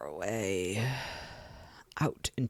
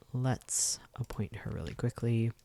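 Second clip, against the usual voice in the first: ordinary talk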